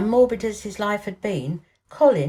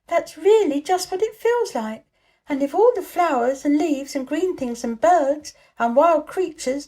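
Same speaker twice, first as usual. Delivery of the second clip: put-on high, louder, eager girl imagining; excited girl; 8 semitones higher, 5 dB louder than usual. A narrator's reading. Mary excitedly pictures spring with Colin. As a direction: gush about the dancing creatures.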